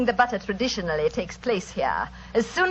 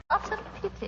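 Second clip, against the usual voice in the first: mock sympathy; 12 semitones higher, 8 dB quieter, faster than usual. Villain mocks Holmes's lateness.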